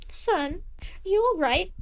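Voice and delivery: a doting mother, casually pleased; cheery mother voice. Acted.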